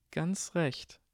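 high, silky purr. A narrator's reading. Draco purrs a taunt at Ron. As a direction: savour the cruelty. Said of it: "schnurrte Draco".

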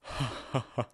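last chuckles dying down; old man's fading laugh. Acted. Dumbledore recovering from laughter, last chuckles. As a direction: wizard catching his breath after laughing. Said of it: "bekam sich mit sichtbarer Mühe wieder ein".

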